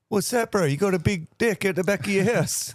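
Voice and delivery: high, loud hammed-up New Zealand bro voice; mock Kiwi accent. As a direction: play a cheeky Kiwi mate. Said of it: "better than a haka".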